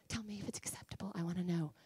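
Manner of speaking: soft whisper to God; whispered prayer. Real, not acted. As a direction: pray in a hushed whisper.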